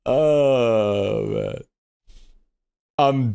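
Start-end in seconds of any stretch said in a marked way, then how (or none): 0.1-1.6 laughing to tears. Real, not acted. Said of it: "I'm actually tearing".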